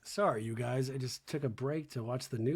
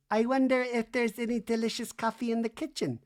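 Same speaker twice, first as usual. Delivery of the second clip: mock Jennifer; 8 semitones higher than usual. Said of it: "Jennifer goes".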